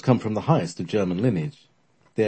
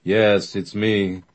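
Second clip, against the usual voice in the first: unworldly voice; 4 dB louder, much slower than usual. Acted. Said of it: "filled with an unworldly voice".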